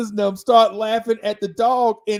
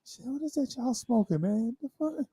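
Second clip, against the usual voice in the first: stoned dog voice; 5 semitones higher, 7 dB quieter than usual. Retold or imitated. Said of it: "The dog is like".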